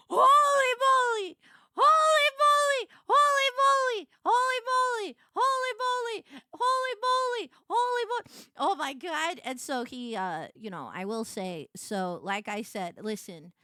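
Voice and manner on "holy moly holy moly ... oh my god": high, loud repeated squeals; squealing. Retold or imitated. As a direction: squeal it again and again, giddy. Overwhelmed squealing.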